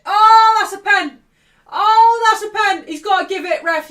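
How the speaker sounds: loud, high outcry at the referee; explosive football fan shout; shouted penalty appeal. Real, not acted. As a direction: yell for a penalty in real outrage.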